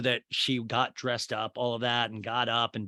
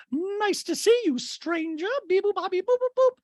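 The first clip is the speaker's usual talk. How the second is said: Dorit; 5 dB louder than usual. Retold or imitated. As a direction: beep it out like a high-pitched robot. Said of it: "And Dorit goes".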